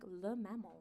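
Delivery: very soft, low pondering; puzzled echo. Retold or imitated. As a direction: mull it over, almost to yourself. Woman repeats it, baffled.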